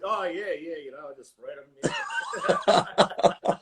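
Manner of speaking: a fake-casual answer breaking into a laugh; sheepish laughing reply; laughing through a bluff. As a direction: laugh your way through the excuse. Retelling his bluffed answer.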